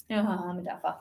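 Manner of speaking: a whispered self-mocking aside. Real, not acted. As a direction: mutter it under your breath.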